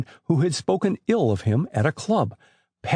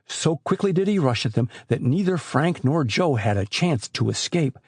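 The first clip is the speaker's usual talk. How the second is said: ordinary delivery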